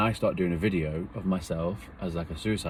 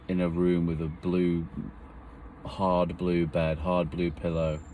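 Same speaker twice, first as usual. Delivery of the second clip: slow, deliberate listing; slower than usual. Real, not acted. Slow emphatic description.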